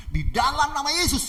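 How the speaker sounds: high, loud invocation; bold faith declaration. Acted. Pastor invoking Jesus' name at the robber, bold and loud. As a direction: proclaim it with full conviction. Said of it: "langsung dia menggunakan dengan iman".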